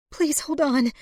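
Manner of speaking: high-pitched, anxious begging; desperate plea. A narrator's reading. Woman to dying lover, high desperate plea. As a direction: beg him to stay alive.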